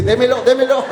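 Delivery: high, eager imitation of the woman; comic sudden eagerness; eager demand. Acts woman eagerly taking the money.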